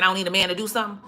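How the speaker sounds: ordinary talk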